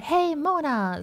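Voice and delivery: friendly vampire. Acted. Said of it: "nicht so ein netter Vampir".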